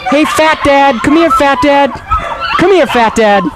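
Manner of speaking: high-pitched coo to a dog; calling a dog. Pretend. Host calls his corgis over, high doggy-calling voice. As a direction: sing out high for the dog to come.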